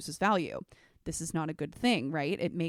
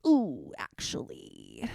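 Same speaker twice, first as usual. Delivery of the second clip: a much lower, slow, drawn-out "Ooh, actually"; mock corporate hedge; 8 semitones lower, slower than usual. Imitating a manager dodging a promised solution, slow low hedge. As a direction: hedge like a boss backing out. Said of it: "you're like".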